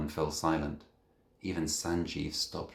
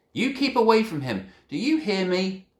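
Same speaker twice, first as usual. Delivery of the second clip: angry mother; 14 semitones higher, 8 dB louder than usual. Acted. Mother angrily warns boy.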